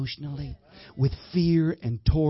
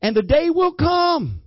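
preaching scripture; 10 semitones higher, 4 dB louder, slower than usual. Acted.

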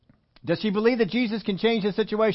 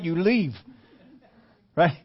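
ordinary delivery

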